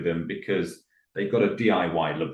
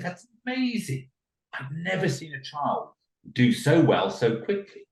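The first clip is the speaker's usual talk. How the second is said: put-on high-pitched gushing to a child; amazed praise; 8 semitones higher than usual. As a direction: lay on the wonder for a child. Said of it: "I was praising Dylan".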